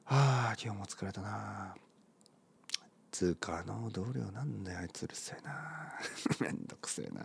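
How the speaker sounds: muttering to himself, very soft; hushed tired grumbling; mumbled whisper. Shown as a demonstration. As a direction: grumble under your breath like a tired worker. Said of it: "こんな声で".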